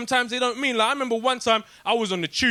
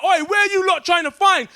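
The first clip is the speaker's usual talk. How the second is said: angry shout; 10 semitones higher, 5 dB louder than usual. Retold or imitated. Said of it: "she was like".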